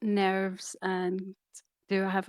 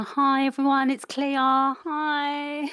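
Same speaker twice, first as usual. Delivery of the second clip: high, bright hello in mock Essex; put-on Essex accent; 5 semitones higher, 3 dB louder, slower than usual. Co-host mimicking her friend's greeting to viewers, Essex accent. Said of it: "It's not how you say it".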